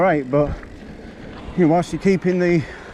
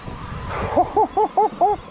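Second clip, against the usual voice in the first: high, breathy wheezing laugh after the climb; breathless laugh; 14 semitones higher than usual. Real, not acted. Rider laughing breathlessly to camera after a tough climb.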